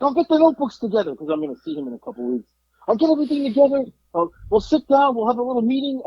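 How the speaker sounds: high, eager planning; eager dad. Retold or imitated. Dad planning the handover meeting, high and eager. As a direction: rattle off plans like an excited dad. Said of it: "He's, like, so excited".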